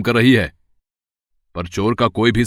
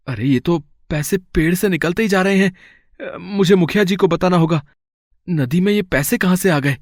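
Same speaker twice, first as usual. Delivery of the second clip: higher, amazed wondering; astonished; 8 semitones higher than usual. Acted. Kishan finds money pouring from the tree, higher and amazed. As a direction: marvel at what you are seeing.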